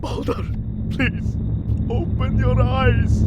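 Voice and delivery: high, loud, anguished pleading; father begging his dead son, from pleading up to a howled name; desperate begging. Acted. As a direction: plead over his body, building to a howl.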